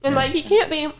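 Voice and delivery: chewing while talking; mouth full. Real, not acted. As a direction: talk through a mouthful. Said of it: "Finish chewing your food".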